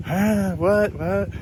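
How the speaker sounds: put-on high, puzzled girl voice; mock confused girl. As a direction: play her baffled and a bit lost. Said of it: "she'll be sitting there looking at you like".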